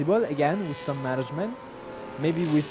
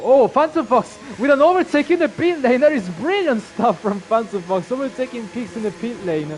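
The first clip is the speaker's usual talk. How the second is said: motorsport commentator; 4 semitones higher, 7 dB louder than usual. Real, not acted. Live pit lane overtake call.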